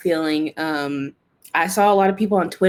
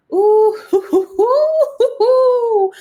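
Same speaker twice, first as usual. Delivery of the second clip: very high, loud "hoo-hoo" squeals; exasperated hooting; 7 dB louder, much slower than usual. Real, not acted. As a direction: let out a string of high hoots, overwhelmed. Worked up over the scene.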